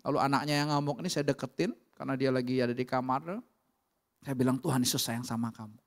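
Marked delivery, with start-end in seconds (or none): 4.6-5.7 gentle comfort. Retold or imitated. Preacher comforting the raging son.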